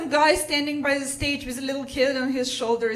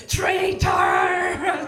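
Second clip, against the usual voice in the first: drawn-out mock roar; hammed-up furious yell, stretched long; imitated soldier's scream; 4 dB louder than usual. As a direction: bellow the accusation after someone fleeing. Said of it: "Russian soldier screaming in my back".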